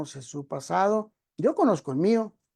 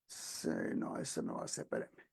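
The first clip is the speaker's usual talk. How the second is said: whispered aside; 14 dB quieter than usual. Real, not acted. Aside while searching the screen.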